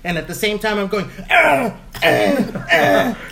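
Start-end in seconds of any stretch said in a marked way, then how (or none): 1.3-3.2 high, loud "ah"; loud acted pain howls; yelling in pain. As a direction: re-enact crying out from a cramp. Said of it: "yelling at the top of my lungs".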